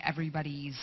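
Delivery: ordinary delivery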